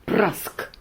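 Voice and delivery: usual speaking voice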